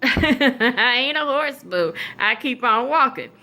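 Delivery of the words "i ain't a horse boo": laughing, slow comeback; answering the teacher's hey, laughing and slow; laughing retort. Retold or imitated.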